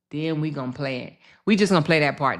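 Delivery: ordinary delivery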